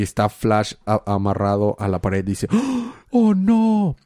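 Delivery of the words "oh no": high hammed-up cry of horror; overacted shocked gasp; mock dismay. Hero cries at frozen head.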